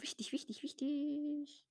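soft whispered repetition; whispering. Real, not acted. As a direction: whisper it triumphantly. Whispered catchphrase.

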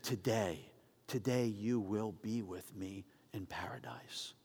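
slow, soft whisper; tender whisper of paradise; whispered promise. Acted. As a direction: breathe the promise to him. Jesus promises the thief paradise.

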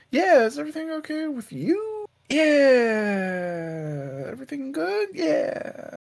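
high, drawn-out fake-casual reply; hammed-up suspicious small talk, stretching the yeahs; squeaky, unconvincing everything-is-fine act; exaggerated awkward reassurance. Mock suspicious mutual check-in.

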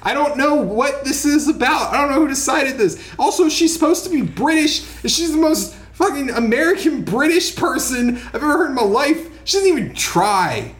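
high-pitched, incredulous complaining; comic outrage at bad casting; exasperated rant. Real, not acted. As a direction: rant in baffled disbelief, pitched well up. Ranting about miscast, clueless character.

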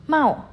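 "mau" loud, high and sharply falling; fourth tone. Shown as a demonstration. Teacher shows students the angry-like tone, falling "mau". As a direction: fling "mau" down like someone angry. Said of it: "nada turun atau nada kayak orang marah".

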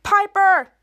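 protesting call. Retold or imitated. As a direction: call out in mock alarm. Dangling friend protests.

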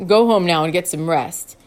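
a wise elder turning in for the night; Edgar's calm deep voice. A narrator's reading.

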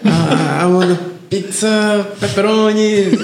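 English in a hammed-up Italian accent, high and slow. On the phone ordering pizza, mock Italian accent. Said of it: "Fala inglês com sotaque italiano".

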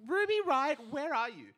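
playful mock call. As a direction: call out as if searching. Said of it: "And I was like".

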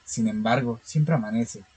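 calm, steady horror story reading; story narration. Acted. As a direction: read it like a measured horror tale. (lectura de cuento de terror)

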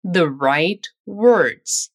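very slow, stretched-out The right words; slow model phrase. Shown as a demonstration. Teacher drilling The right words very slowly. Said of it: "Vamos praticá-lo nesse trecho".

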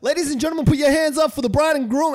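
mock MC shouting to the room; announcer voice. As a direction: hype the crowd like a wedding MC. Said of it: "They'll be like".

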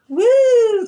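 high drawn-out "woo"; carefree woo. Acted. Describing herself coasting through life, a carefree high whoop. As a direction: whoop it out like someone breezing along.

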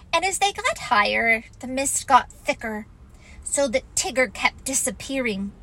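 plain reading voice

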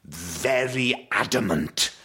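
very slow, stretched-out posh Welsh. Shown as a demonstration. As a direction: imitate a Welsh dad putting on airs, word by word. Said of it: "he puts on a rather posh voice".

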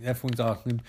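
ordinary delivery

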